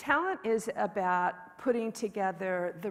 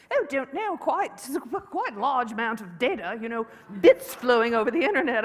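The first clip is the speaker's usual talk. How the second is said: a hint of a British accent, higher and louder; lightly British colleague; 5 semitones higher, 8 dB louder than usual. Head of product explaining the bandwidth, a light British accent. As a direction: play the British head of product, breezy and a bit lofty. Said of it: "said, British guy".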